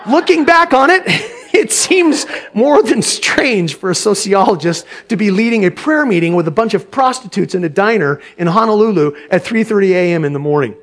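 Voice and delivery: ordinary delivery, nothing marked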